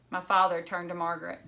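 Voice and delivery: plain reading voice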